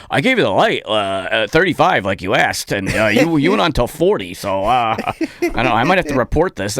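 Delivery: high, loud prissy host voice; mock the host's self-righteous complaint; imitating the host. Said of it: "He goes".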